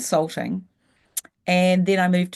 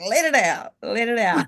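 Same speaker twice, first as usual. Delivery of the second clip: other inner voice urging; 4 semitones higher than usual. Retold or imitated. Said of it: "the other voice going".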